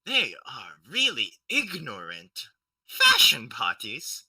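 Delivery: disdainful. Acted. Soldier character sneering in thought.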